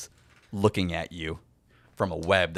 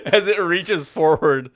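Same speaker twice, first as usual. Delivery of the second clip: narration broken up by real laughter, louder; laughing; 10 semitones higher, 12 dB louder than usual. Real, not acted. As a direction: laugh through the words.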